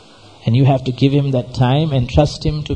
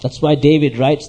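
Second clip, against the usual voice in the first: normal talking voice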